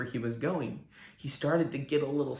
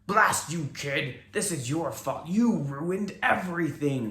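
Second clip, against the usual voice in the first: a lightly voiced angry yell, a bit higher and louder; villain yelling at the boy who ruined his plan; 5 semitones higher, 3 dB louder than usual. A narrator's reading. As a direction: blame the kid furiously. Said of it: "he yelled".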